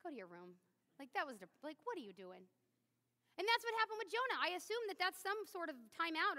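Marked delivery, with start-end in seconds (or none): 0.0-2.5 lower, softer stern parent; a hint of a disappointed father sending a child off; God scolding a sulker, quietly stern. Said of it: "He's gonna be like".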